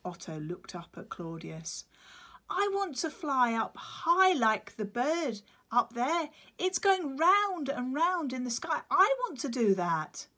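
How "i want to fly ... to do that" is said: high, loud longing little chick; eager chick. Acted. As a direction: dream out loud like a small child. Chick Otto voiced.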